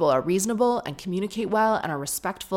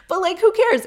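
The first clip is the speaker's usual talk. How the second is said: loud and high, who cares; exasperated; 12 semitones higher, 7 dB louder than usual. Real, not acted. Host shrugs off the offended customers, high and loud. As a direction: toss it off, high and loud, scoffing. Indignant retort.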